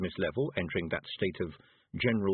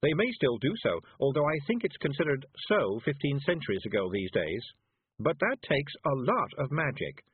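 lightly voiced, higher character voice; Miss Level, considering; 6 semitones higher than usual. A narrator's reading. Miss Level answers the Feegles about wizards, lightly voiced, higher. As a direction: explain it in a slightly raised voice.

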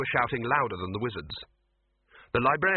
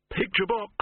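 commanding shout; 8 semitones higher than usual. A narrator's reading. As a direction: call it out like a director. Victor commands the scene.

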